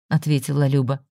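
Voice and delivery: usual speaking voice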